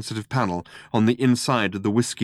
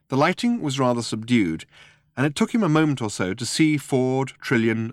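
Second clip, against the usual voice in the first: ordinary delivery, nothing marked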